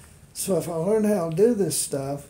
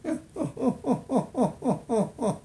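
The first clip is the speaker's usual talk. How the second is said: high falsetto giggle; 7 semitones higher than usual. Acted. Dummy laughs after howl.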